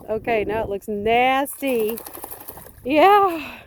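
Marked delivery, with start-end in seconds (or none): 2.8-3.6 higher, fond voice for the dog; pet talk. Real, not acted. Owner teasing her wet dog, high fond pet talk.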